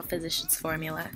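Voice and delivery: ordinary talk, nothing marked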